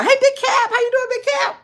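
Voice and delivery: high-pitched, delighted hello; excited greeting. Real, not acted. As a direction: squeal a welcome to someone just arriving. Greeting a newcomer to the stream.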